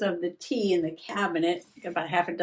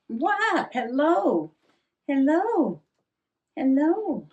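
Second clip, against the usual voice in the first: higher, louder hello to the pet; greeting cat; 6 semitones higher, 4 dB louder, much slower than usual. Real, not acted. Greeting her cat, higher, louder calling hello. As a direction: call hello up high to the cat.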